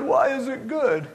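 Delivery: high, loud mock disbelief; incredulous crying man. Counseled man imitated questioning the pastor, incredulous. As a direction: throw the word back, high and upset. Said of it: "And he goes".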